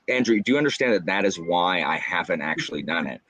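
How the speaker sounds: ordinary talk, nothing marked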